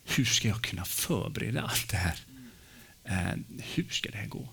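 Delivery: hushed, worried whisper to himself; anxious inner voice. Retold or imitated. Pastor alone in church, panicking about the sermon, hushed whisper. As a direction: breathe the panic out under your breath.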